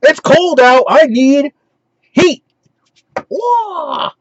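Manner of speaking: high, loud mock battle cry; hammed-up ninja wail; Winter Ninja. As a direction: holler it like a cartoon ninja. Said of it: "it's the Winter Ninja".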